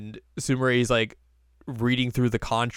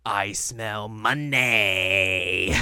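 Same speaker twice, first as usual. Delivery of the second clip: slow, drawn-out greedy whisper-voice; greedy scheming; much slower than usual. Retold or imitated. Host voicing the scheming character discovering money, slow and greedy. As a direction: savor every word like a villain smelling cash.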